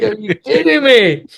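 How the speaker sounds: loud, high, drawn-out disbelief; incredulous outburst. Retold or imitated. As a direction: cry out in exasperated amazement, half laughing. Exclaims over missed chances, laughing.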